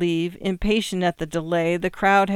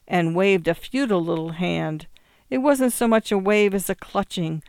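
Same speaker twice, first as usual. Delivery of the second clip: ordinary delivery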